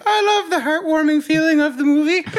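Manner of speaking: a hint of a sentimental fan; mocking impression. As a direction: echo the teasing imitation. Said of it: "that's how he says things".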